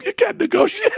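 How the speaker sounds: high, laughing through the punchline; giggling punchline. Real, not acted. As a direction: crack up on the words.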